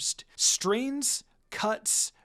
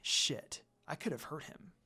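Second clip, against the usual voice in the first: soft, breathy thought; quiet alarmed thought; hushed inner curse; 4 semitones lower, 9 dB quieter than usual. Acted. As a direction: mutter it under the breath.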